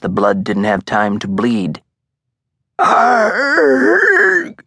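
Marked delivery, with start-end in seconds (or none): 2.8-4.6 high, loud pained yell; agonized howl; scream of agony. A narrator's reading. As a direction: scream as if stabbed. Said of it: "approximation of the sound Marcosa made".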